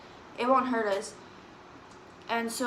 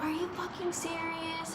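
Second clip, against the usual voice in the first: livid mom; 6 semitones higher, 6 dB quieter than usual. Retold or imitated. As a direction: imitate an incensed mom in a raised pitch, stretching the words. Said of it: "My mom was livid".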